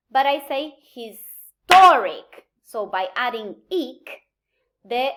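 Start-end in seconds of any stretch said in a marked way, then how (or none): 0.9-2.5 "historic" with a loud, stretched TOR; stress on TOR. Shown as a demonstration. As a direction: slowly punch the middle syllable of "historic". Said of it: "the stress pattern of the root changes".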